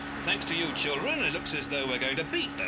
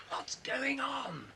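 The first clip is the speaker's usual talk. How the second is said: whisper; 8 semitones higher, 6 dB quieter, much slower than usual. Acted. Waking prisoner whispers.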